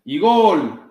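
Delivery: loud, high excited gol; goal call. A narrator's reading. Narrator calling the goal in Spanish, excited. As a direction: shout the goal with joy.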